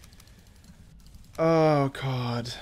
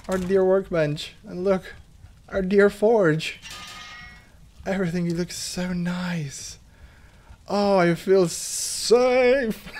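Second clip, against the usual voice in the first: slow, higher, doting over each item; mock-tender homecoming, drawn out; hammed-up sentimental; 5 semitones higher, slower than usual. Gamer back at his base, gushing over his dear forge, hammed-up tender. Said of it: "This is silly".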